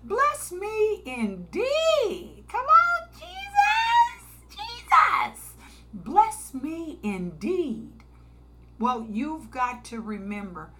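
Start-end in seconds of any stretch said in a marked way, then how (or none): 0.0-2.4 slow, high pleading prayer; drawn-out higher plea "Bless me indeed". Retold or imitated. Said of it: "like Jabez". 2.5-5.5 much higher, louder cry; high fervent holler; crying out to Jesus. Real, not acted. Crying out in prayer. 5.9-8.0 slow plea with less lift; "Bless me indeed" slow and steady; pleading prayer. Retold or imitated. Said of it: "like Jabez".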